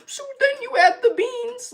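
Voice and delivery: squeaky high panicked self-quote, slow; mock panicked high voice. Recalling a cooking disaster to viewers, mock panicked squeak. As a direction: play her past self squeaking in panic.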